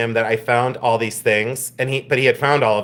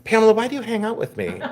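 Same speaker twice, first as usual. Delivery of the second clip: laughing; 8 semitones higher, faster than usual. Real, not acted. Laughing at her own antics.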